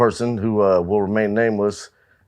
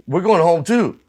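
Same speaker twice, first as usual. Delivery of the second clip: mock band guy; 9 semitones higher, 3 dB louder than usual. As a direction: pitch it up for the other guy. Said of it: "He goes".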